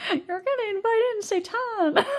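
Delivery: high, horrified mother's warning; alarmed mom warning. Retold or imitated. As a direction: warn like a panicked mom. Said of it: "My mom was like".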